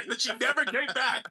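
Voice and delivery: ordinary talk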